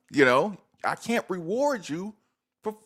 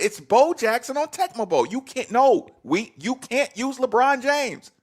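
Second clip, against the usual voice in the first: high, emphatic protest; throwing a fit; 6 semitones higher, 3 dB louder than usual. Real, not acted. Objecting to LeBron in the game, throwing a fit. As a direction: argue it indignantly, high-pitched. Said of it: "you do throw a fit".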